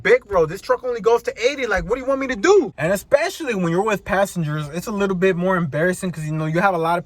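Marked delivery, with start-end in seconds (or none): 0.0-2.7 higher-pitched, exasperated comeback. Retold or imitated. Driver answering people who stare as they pass, exasperated. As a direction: protest with mild indignation.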